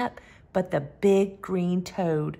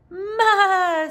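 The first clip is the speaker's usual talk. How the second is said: a high goaty ma; goat bleat; 9 semitones higher, 4 dB louder than usual. Acted. Goat greeting the truck, bleating. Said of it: "said Goat".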